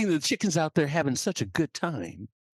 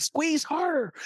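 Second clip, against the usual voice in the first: high, drawn-out order; high, insistent command; commanding eagle; 13 semitones higher, slower than usual. Acted. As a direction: urge it on with a long high-pitched word. Eagle commands chick more.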